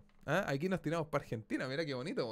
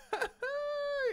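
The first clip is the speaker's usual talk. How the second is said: short, piercing squeal of delight; high squealed laugh. Real, not acted. Streamer delighted by the game map, high squealing laugh.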